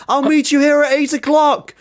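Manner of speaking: high-pitched hollered call, not full volume; mock scream. Man screaming after the woman who runs off, mock scream. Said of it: "and he's screaming".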